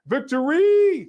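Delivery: loud, drawn-out cheer, pitched high; slow, belted victory cry; excited shout. Real, not acted. Emcee cheering a donor's victory message, loud drawn-out shout.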